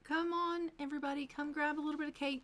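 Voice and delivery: high, sing-out summons to guests; calling everyone in. Acted. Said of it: "she says".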